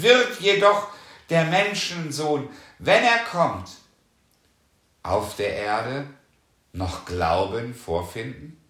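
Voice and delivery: normal talking voice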